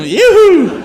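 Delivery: loud high yoo-hoo; loud playful shout; calling out. Real, not acted. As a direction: call out to the crowd. Calling out to Polynesians.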